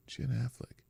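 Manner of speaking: absent-minded whispered mumble; muttering to self. Real, not acted. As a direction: murmur it under your breath while searching. Muttering to self while searching online.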